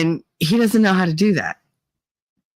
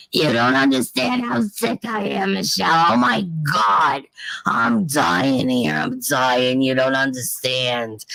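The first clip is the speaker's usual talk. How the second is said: slow, dragging, dying melodrama sinking lower; exaggerated mother impression; 4 semitones lower, slower than usual.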